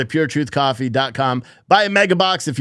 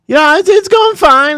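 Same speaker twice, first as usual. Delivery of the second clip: pitched way up, louder, straining; strained high; 6 dB louder than usual. Retold or imitated. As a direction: sing it out high under the load. Lifter stressed mid-deadlift.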